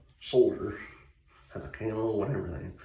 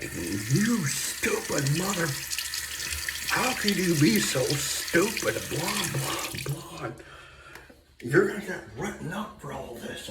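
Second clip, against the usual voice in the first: high, loud angry yell, imitating dad; mock a furious dad chewing someone out; retold screaming; 8 semitones higher, 3 dB louder than usual. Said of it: "just screaming at this guy".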